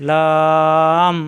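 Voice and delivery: লাম with a very long level vowel; drawn-out letter name. Shown as a demonstration. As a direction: hold লাম steadily before closing the lips. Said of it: "টান দিয়ে পড়া যায়".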